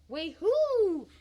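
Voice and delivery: high, loud, drawn-out whoop; exultant whoop. Acted. Husband celebrating his windfall, exultant whoop. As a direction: let the last syllable soar. Whoops after being corrected.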